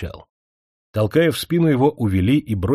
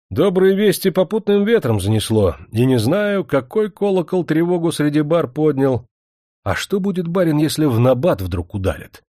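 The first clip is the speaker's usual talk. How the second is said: дерзко; 7 semitones higher, 4 dB louder than usual. Acted. Кашкин дерзко отвечает князю.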